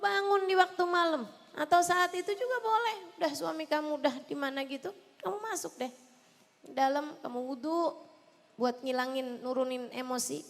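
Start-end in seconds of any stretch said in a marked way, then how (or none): none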